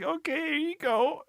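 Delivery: high, slow mock-tearful voice; mock crying. Kaorin paying Tomo, in hammed-up tears. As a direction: hand it over as if about to cry, hammed up. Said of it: "like, crying".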